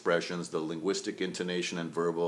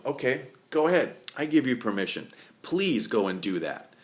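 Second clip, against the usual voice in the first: sarcastic permission; 6 semitones higher, 4 dB louder than usual. Retold or imitated. Woman with crossed arms to the man, sarcastic.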